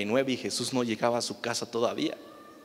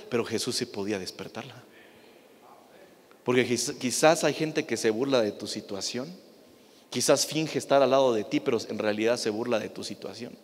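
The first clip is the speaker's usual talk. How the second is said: usual speaking voice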